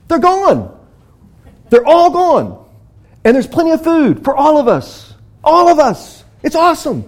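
breathless joyful telling; excited report. Acted. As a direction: burst out with the news.

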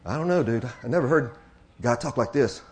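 a hint of a bemused messenger; awed, casual spy. Spies reporting back to the Pharisees, lightly acted bemusement.

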